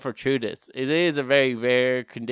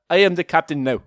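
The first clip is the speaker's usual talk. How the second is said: African accent, higher than usual; mock African accent; 4 semitones higher, 4 dB louder than usual. Shown as a demonstration. Comic quoting a movie line to co-host, African accent. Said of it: "in African accent".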